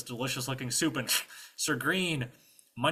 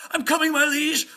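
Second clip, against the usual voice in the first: high, loud, struggling squire; pathetic squire; 12 dB louder than usual. Acted. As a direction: strain it out while dragging yourself along. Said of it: "he's dragging himself pathetically".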